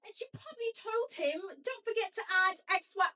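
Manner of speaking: high hammed British accent; mock British accent. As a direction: do a posh British Victoria, hammed-up. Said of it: "My British accent is typically top tier".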